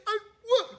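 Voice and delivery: ordinary delivery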